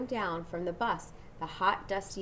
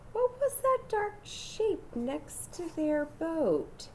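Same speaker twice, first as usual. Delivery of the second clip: slow, hushed suspense; 9 semitones higher, slower than usual. A narrator's reading. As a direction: draw out the mystery slowly. Suspense in the story.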